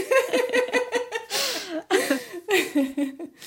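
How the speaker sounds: bright high laugh; high laughter. Real, not acted. Host laughs after guest's intro, high laughter.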